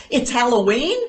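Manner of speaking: loud, rising disbelief; surprised child. Retold or imitated. Retold child's wide-eyed surprise.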